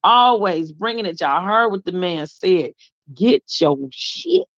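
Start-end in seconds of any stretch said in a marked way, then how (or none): 3.1-4.5 "shit" drawn out and higher; quoted slogan, hanging on "shit"; stress on SHIT. Retold or imitated. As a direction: lean slowly into the word "shit". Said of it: "You gotta put emphasis on that word".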